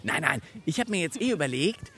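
ordinary delivery

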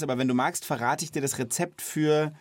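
ordinary talk

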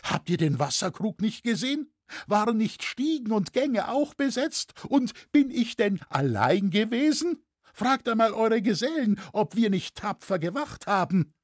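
high, whining lament; plaintive high-pitched pleading; wailing. A narrator's reading. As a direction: protest innocence in a wailing voice. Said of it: "jammerte Hetzer wieder".

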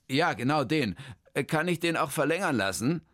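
a hint of a flustered man; lightly voiced, hesitant man; Hermann. Hermann stumbles over his long new name, lightly voiced.

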